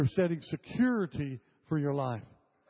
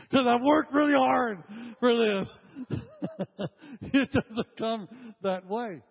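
real laughter running through the line, high and loud; can't stop laughing while joking about his gray hair; 7 semitones higher, 4 dB louder, slower than usual. Real, not acted. Preacher to congregation, laughing through the joke.

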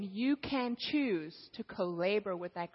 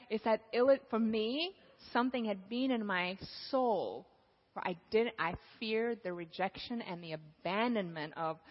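ordinary talk

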